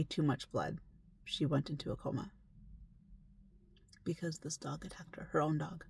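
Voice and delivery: whispered, hushed and grave. Real, not acted. Telling the client's grim story, whispering. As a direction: drop to a whisper for the grim part.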